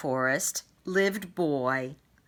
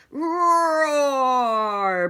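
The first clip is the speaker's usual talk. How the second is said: loud high drawn-out roar; dragon bellow; 9 semitones higher, 7 dB louder than usual. Acted. Dragon bellowing loudly at battlefield. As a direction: bellow like a huge beast. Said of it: "bellowed the dragon".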